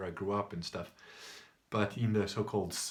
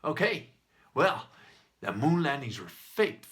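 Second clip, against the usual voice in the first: louder, higher mock comeback; retold shouty man; 4 semitones higher, 7 dB louder than usual. Shouty man answering the bald man's challenge, loudly defiant. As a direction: take up the challenge in punchy short bursts. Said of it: "And the other guy goes".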